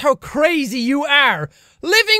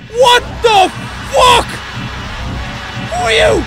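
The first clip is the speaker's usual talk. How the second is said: startled panic; 3 semitones higher, 4 dB louder than usual. Real, not acted. Gamer jumping at a scary stranger, loud panicked shouting. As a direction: yell out in real fright.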